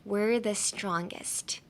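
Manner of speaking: very slow, soft model of WE'RE THE STRONGEST; careful, drawn-out WE'RE THE STRONGEST; slow pronunciation. Shown as a demonstration. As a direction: stretch out WE'RE THE STRONGEST for learners. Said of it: "One more time".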